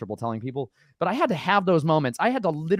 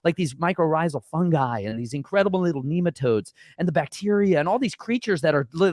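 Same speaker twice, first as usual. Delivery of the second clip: ordinary delivery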